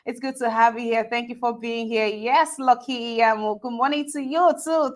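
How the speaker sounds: ordinary delivery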